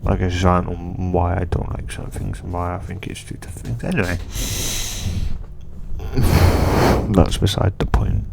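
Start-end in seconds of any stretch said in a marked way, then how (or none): 4.2-7.1 long, breathy exhale; long sigh. Real, not acted. Sighs before dropping the tangent.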